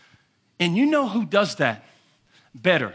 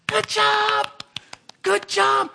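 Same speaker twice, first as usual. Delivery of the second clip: proud parent praise; 13 semitones higher, 4 dB louder, much slower than usual. Retold or imitated. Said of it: "You're just like".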